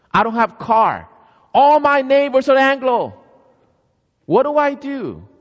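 high, loud, pleading cry to God; anguished prayer. Acted. Grandmother pouring out her helplessness to God, loud and pleading. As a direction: plead with God, voice climbing in distress.

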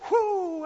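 high yelp of surprise; startled whoo. Pretend. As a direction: yelp like you got burned. Pharaoh reacts to something hot.

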